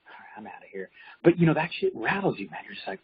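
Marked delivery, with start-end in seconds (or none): 0.1-0.9 very soft retold aside; hushed, giving-up reply; quiet retreat. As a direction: back away in a low-volume voice. Quiet retreat from screaming man.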